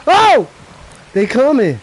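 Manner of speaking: sudden high loud cry of alarm; startled shout. Real, not acted. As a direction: yelp as they close in. Creatures rushing at him.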